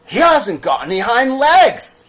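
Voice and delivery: high, loud mocking call. Wild rabbit calling out the toy's missing legs, loud. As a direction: announce it to everyone. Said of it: "he called out".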